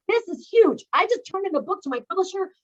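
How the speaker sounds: retold excited boast to her family; excited announcement. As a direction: proudly announce it to the family, a bit hammed up. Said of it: "I'd be like".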